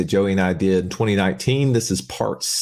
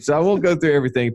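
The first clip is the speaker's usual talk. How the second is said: usual speaking voice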